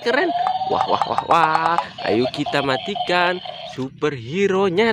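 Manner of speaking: ordinary delivery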